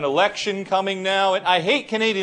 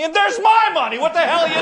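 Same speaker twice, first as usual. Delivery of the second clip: much higher, louder burst of indignation; outraged taxpayer; 10 semitones higher, 4 dB louder, faster than usual. Acted. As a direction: explode in comic outrage on the repeat. Acted taxpayer outrage at matched funds.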